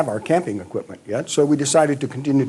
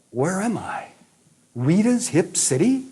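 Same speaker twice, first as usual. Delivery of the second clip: high, rising, slowed mock amazement; surprised disbelief; 8 semitones higher than usual. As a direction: repeat the name as if you can't believe it. Arriving at an oddly named nightclub.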